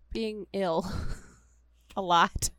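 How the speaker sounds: words broken by laughter; laughing through words. Real, not acted. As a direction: giggle mid-sentence. Laughing while explaining podcast.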